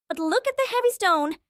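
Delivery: usual speaking voice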